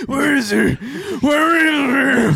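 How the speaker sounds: high, shouting, strained and drawn out; hammed-up frantic shouting search; frantic crackhead voice. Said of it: "your neighborhood crackhead going nuts".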